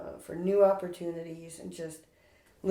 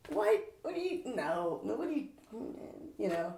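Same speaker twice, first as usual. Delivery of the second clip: retold bewilderment, breaking off mid-question; mock flustered, softly squeaking the questions; baffled disbelief; 2 semitones higher, 7 dB quieter than usual. Retells baffled reaction to podcast suggestion.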